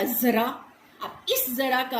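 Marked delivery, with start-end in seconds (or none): 0.0-0.4 जरा said with a z, like zara; teacher reading ज़रा with nukta; z sound. Shown as a demonstration. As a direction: say जरा with a buzzing z. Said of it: "तो ये क्या बन गया".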